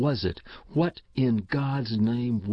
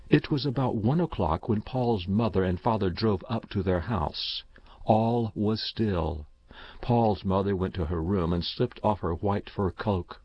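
plain reading voice

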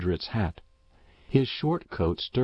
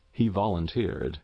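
ordinary delivery, nothing marked

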